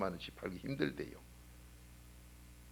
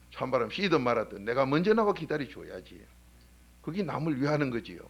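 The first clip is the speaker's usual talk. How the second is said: loud, driving sermon voice; fervent revival preacher, louder; preaching at full force; 4 semitones higher, 7 dB louder than usual. Acted. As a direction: preach it hard to the pews. Preacher drives home the lesson.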